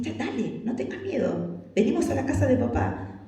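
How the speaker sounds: soft, slightly lilting encouragement; gentle coaxing. Acted. Encouraging someone coming to Father's house, gentle reassurance. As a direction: coax them softly along.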